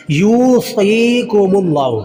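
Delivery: यूसीकुमुल्लाहु slowly, yoo, see and laa held; lengthened vowels. Shown as a demonstration. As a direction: stretch each long vowel of यूसीकुमुल्लाहु. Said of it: "या को लंबा करेंगे". (यूसीकुमुल्लाहु में यू, सी, ला लंबा)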